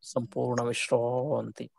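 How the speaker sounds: calm shavasana guidance; guided relaxation. Real, not acted. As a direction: ease them into complete rest, unhurried, letting phrases sink away. Guides the class through final relaxation.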